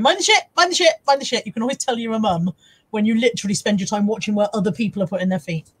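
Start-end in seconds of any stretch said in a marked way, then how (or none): 0.0-1.4 high, repeated mock warning call; urgent mum warning, pitched up; mum's warning. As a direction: call it out like an over-watchful mum. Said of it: "walking around the streets with other adults going".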